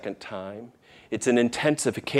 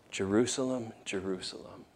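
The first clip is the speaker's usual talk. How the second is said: weeping; 2 semitones lower, 11 dB quieter than usual. Retold or imitated. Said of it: "He's weeping over the city".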